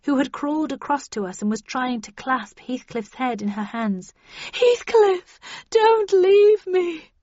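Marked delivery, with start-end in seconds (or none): 4.5-7.1 very high, loud, drawn-out plea; desperate melodramatic cry; anguished cry. A narrator's reading. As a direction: wail for your lover to stay. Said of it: "cried the older Catherine".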